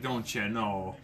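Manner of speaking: put-on light Wisconsin accent on a stock phrase. Podcaster showing his buddy how Wisconsin sounds, light Wisconsin accent. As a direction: imitate a Wisconsin local, lightly. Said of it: "that's clearly the sound of, like, Wisconsin".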